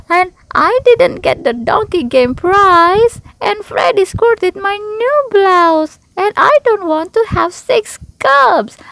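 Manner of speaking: high, loud wailing cub voice; tearful child. Acted. Sister listing her woes to Mama, high tearful wail. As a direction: pour out every grievance through tears. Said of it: "Sister said between sobs".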